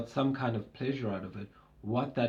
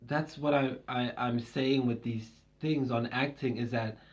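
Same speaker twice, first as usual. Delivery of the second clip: usual speaking voice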